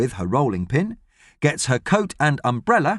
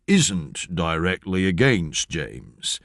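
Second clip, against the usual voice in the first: slow low police officer; 7 semitones lower, much slower than usual. Acted.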